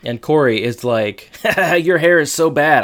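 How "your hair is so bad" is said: laughing, higher and louder jeer; mocking laughter. Corey teasing Topanga about her crimped hair, mocking laughter. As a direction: laugh out loud at the awful hair.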